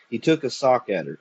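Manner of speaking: usual speaking voice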